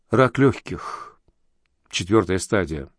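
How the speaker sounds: slow, low, tearful words; sobbing. A narrator's reading. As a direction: let it break on the last words. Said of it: "всхлипнула бедняжка".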